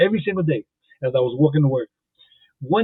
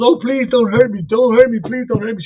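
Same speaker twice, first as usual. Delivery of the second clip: much higher, pleading mock terror; hammed-up frightened lady; 7 semitones higher than usual. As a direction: play the scared lady begging, hammed up.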